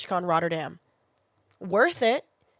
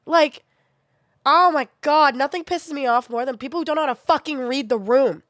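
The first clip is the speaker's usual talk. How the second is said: louder, higher furious rant; 6 semitones higher, 5 dB louder than usual. Real, not acted. Ranting at tactless viewers, furious and loud. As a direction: let the frustration boil over. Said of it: "don't have a filter right now".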